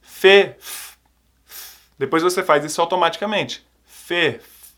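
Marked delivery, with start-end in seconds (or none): 0.6-1.8 f soprado de exercício; blown f sound. Shown as a demonstration. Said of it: "um exercício". 4.3-4.7 f f soprados baixinho; blown f sound. Shown as a demonstration. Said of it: "esse som de f".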